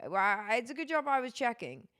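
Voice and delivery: normal talking voice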